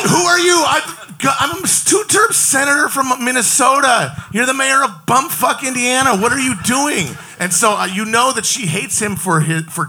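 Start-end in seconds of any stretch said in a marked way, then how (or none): 0.1-7.1 hammed-up furious senator rant; indignant shout. Impersonating Klobuchar confronting Pete.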